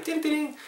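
high chime imitation "띠리띠링"; voiced chime sound effect; sung jingle. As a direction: sing a little segment jingle, very high. Sings a segment jingle.

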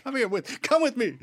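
a hint of an angry old woman, pitched high; angry command. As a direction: snap out a high, bossy order. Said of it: "And she's very angry".

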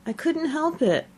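sulky high whine. A narrator's reading. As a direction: sulk it out, defensive. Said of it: "said Five in a sulky tone".

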